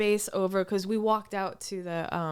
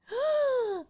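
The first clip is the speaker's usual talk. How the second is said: sharp gasp. Retold or imitated. Retelling seeing the guitarist.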